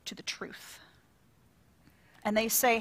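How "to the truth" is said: hushed dramatic whisper. Real, not acted. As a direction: breathe it out for emphasis. Dramatic sermon climax.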